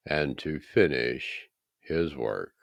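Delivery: ordinary delivery, nothing marked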